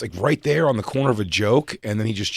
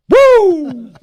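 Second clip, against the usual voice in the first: piercing high shout from the crowd; audience whoop; 12 dB louder than usual. Retold or imitated. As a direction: whoop out alone in dead silence. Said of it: "one person goes".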